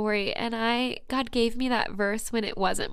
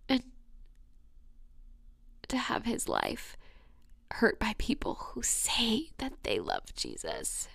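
soft, breathless whisper about being hurt; intimate whisper; 9 dB quieter than usual. Real, not acted. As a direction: whisper it like a secret wound. Whispers about betrayal again.

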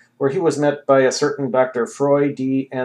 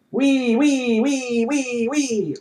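high, gleeful wees fading as he runs; wee-wee down the hallway like a madman; manic wee-weeing; 12 semitones higher, much slower than usual. A narrator's reading. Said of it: "bolted out ... shouting".

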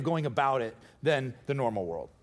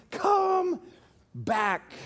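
crowd calling back; 12 semitones higher than usual. Retold or imitated. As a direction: shout it up high like pulling someone back. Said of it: "They're saying".

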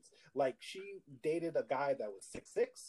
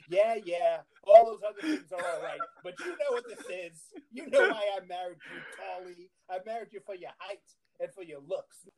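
higher, laughing, teasing wife voice; imitated sarcastic wife; 4 semitones higher, 4 dB louder than usual. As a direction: tease him like a wife who married him for his height. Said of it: "just being sarcastic".

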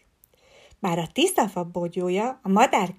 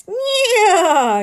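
a loud, high whinnying neigh; horse neigh; 9 semitones higher, 5 dB louder than usual. A narrator's reading. Leopold the foal whinnies at his friends, loud and high. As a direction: let out a big playful horse whinny. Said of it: "Nyerített nagyot Leopold".

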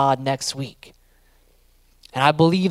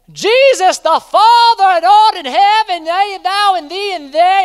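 much higher, hammed-up churchy prayer voice; mock formal prayer; 6 dB louder, slower than usual. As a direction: parody a stiff, pompous King James prayer, pitched way up. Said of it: "Prayer does not have to be like".

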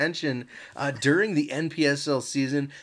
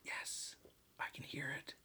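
hushed, breathless whisper; 21 dB quieter than usual. Real, not acted. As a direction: whisper so the mic hears. Whispering during the chug.

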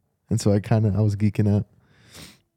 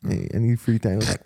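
ordinary talk

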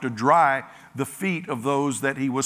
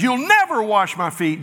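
higher, louder refusal with never pushed; emphatic refusal; 6 semitones higher, 3 dB louder than usual. Acted. Peter flatly refuses Jesus, higher and louder. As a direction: Peter digging in his heels. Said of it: "goes from one end to the other".

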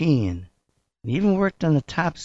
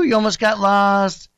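ordinary talk

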